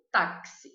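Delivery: "táxi" with a crisp KS for the X; KS sound. Shown as a demonstration. Teacher modelling the Spanish X sound. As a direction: hit the KS in "táxi". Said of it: "A número um".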